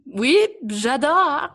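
high, loud, drawn-out French flourish; theatrical French accent; hammed-up French. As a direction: ham up the French, slow and loud. Hammed-up French phrase for laughs.

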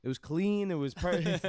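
high, loud protest to a teasing friend; loud insistence. Real, not acted. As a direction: insist emphatically, voice jumping up. Insists after friend's teasing questions.

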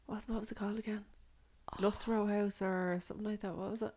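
hushed spooky whisper. Acted. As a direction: whisper it like a ghost story. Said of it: "gonna tell it in a spooky voice".

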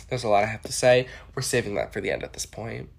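usual speaking voice

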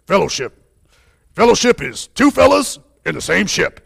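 a light hint of a big, deep-voiced pastor; Ken Graves's deep voice. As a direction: lightly put on a booming preacher's tone. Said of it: "got a big, deep voice".

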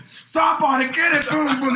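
high, full-blown panicked scream; maniacal screaming. Retold or imitated. Cook screaming at his manager about the chickens, maniacal shouting. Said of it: "this maniacal motherfucker".

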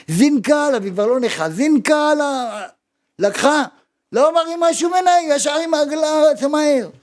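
high, fast, excited grab for the cash; snatch it like a greedy old woman miraculously cured; old woman lunging. Acted. Greedy old woman in a wheelchair grabs the dollars, high and hurried.

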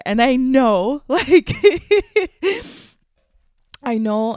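loud, high laughing words; laughing loudly. Real, not acted. Podcaster laughing at her own bad writing. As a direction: burst out laughing mid-sentence.

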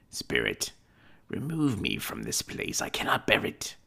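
put-on an old miser begging the spirit to stop; old Scrooge, pleading. Scrooge begs the ghost.

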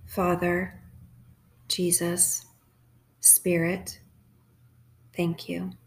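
unhurried prayer in short, separated phrases; calm, measured meditative praying; guided prayer meditation. Acted. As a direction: leave long silences for listeners to repeat each phrase.

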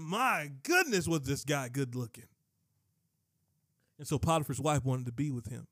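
ordinary talk, nothing marked

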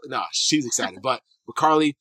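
usual speaking voice

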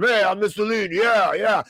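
lightly hammed-up strongman boast; mocking the dictator in a lightly put-on voice; Mussolini impression. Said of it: "Mussolini-style fascism".

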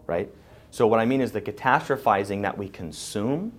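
normal talking voice

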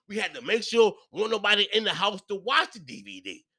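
ordinary talk

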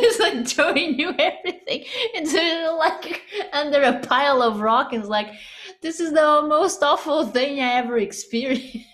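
high, loud, through laughter; telling it while laughing. Real, not acted.

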